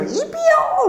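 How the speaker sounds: very high, drawn-out mimicked class chorus; kids in unison. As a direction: squeal it like a whole class answering. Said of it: "단체로". (단체로 외치는 아이들 흉내, 아주 높게)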